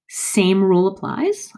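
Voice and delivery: normal talking voice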